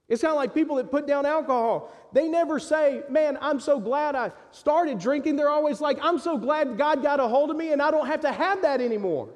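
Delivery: fiery pulpit preaching; full-force preaching. Acted. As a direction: lift it up and drive it home to the congregation.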